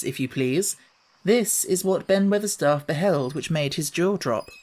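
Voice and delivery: usual speaking voice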